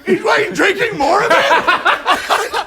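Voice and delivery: ordinary talk, nothing marked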